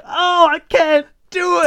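high, loud, slow wail; acted exasperated cry. Dad failing at compassion, exasperated wail. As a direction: give up in a drawn-out high cry.

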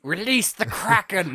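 high, loud, slow dramatic command; theatrical cry to unleash a sea monster; movie villain shout. Pretend. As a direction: bellow it like an epic movie villain. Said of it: "I can't do a British accent".

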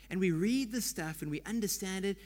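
usual speaking voice

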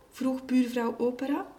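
ordinary delivery

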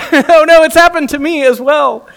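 high, loud, hammed-up dismay; mock horror. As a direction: squeal it like you've caught yourself. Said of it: "When you're like".

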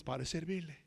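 low, hushed, humble reply; calm helpful stranger. Retold or imitated. Man offers help with the sound machine, low and soft. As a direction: drop right down, soft and unfazed.

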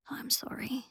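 faint unvoiced whisper; frail old man whispering. A narrator's reading. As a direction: breathe it out, barely there. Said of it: "he whispered to Harry".

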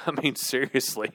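said through a soft laugh; soft laughing repeat. Real, not acted. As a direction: chuckle the words out, amused.

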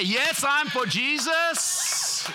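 high, very slow ringing declaration; proud declaration. Acted. Preacher voicing a bold believer's declaration to church, high and slow.

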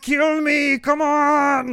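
very high, loud Arnold yell; Arnold impression. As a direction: scream the taunt like Arnold. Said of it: "a lot of Arnold quotes".

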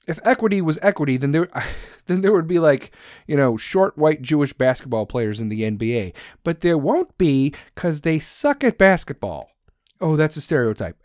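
usual speaking voice

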